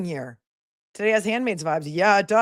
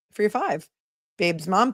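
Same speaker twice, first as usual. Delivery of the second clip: ordinary delivery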